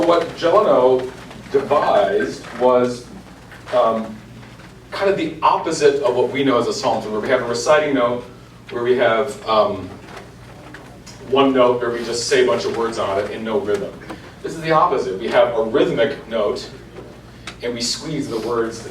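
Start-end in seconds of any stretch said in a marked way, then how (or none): none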